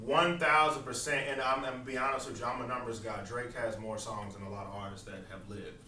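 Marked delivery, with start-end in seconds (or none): none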